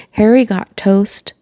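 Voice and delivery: plain reading voice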